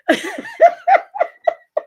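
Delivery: shrieking high burst of laughter. Real, not acted. Recalling the judge's ruling, a high shrieking laugh. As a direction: let a high cackle burst out.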